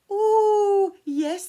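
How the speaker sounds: sneaky goblins pretending to agree; giggling goblins. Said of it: "giggling and nudging".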